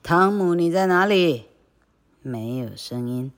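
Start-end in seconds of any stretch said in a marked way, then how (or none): 0.0-1.5 louder call asking where he is; 大声呼喊. Acted. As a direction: call into the house for him. Aunt calling for Tom.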